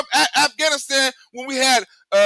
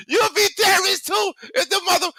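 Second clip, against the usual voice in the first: outraged shout at the hypocrisy; indignant shout; 7 semitones higher than usual. Real, not acted. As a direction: throw the accusation back hard. Heated rant about terrorism.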